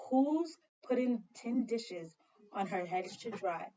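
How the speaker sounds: ordinary delivery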